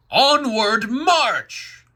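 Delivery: loud, high, drawn-out marching order; battle cry. Acted. Satan leading the dark angels, commanding shout. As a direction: bellow it long and high to the troops.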